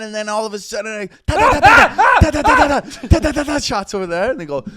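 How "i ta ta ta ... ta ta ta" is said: loud high mock machine gun; mock gunfire sounds, higher and louder; gunfire imitation. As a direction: rattle it out like gunfire.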